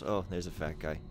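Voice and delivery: normal talking voice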